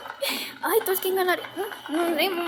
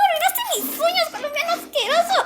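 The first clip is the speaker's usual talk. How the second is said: very high indignant cry; outraged shriek; 10 semitones higher than usual. Acted. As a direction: shriek at the ruined nails. Pelea, uñas rotas. (reproche agudo e indignado)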